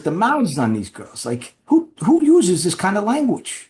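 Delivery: high, slow mock-offended mobster voice; imitating the mob boss. Mob boss mocking the women on TV, high and offended. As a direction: squeak it out like an outraged old-school mob boss. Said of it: "And he says".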